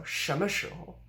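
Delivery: 什么时候 with 么 and 候 light and short; fifth-tone syllables. Shown as a demonstration. Teacher modelling the word for when, light fifth tones. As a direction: say 什么时候 with a small rise on 时, unstressed endings. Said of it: "the fifth tones".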